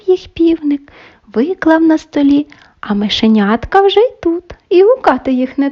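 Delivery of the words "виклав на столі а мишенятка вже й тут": ordinary delivery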